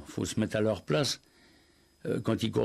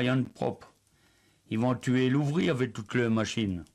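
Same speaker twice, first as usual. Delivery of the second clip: reading in Ardennes patois. Acted. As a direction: read it like telling an old village tale in patois. Reads his patois text aloud.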